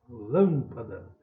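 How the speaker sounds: LÂM held long in lâmpada; stressed first syllable. Shown as a demonstration. Teacher calling the word for pupils, stressed LÂM in lâmpada. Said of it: "Pra chamar a palavra".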